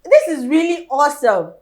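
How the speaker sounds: loud, high-pitched gushing in real amazement; thrilled host raving at full voice; amazed excitement. Real, not acted. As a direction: exclaim as if blown away. Host amazed by accent demonstration.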